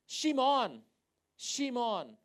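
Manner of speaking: "Shimon" loud and high, Hebrew sh at the start; Hebrew pronunciation. Shown as a demonstration. Preacher shows the Hebrew name, "Shimon" projected with sh. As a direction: call out "Shimon" with a falling end, Hebrew style. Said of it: "pakai bahasa Ibrani dapet nih".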